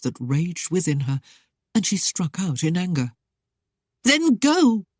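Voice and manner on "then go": higher, louder angry outburst; cried out. A narrator's reading. Jealous lady crying out at her suitor, higher and louder. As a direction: hurl it at him. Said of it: "she cried".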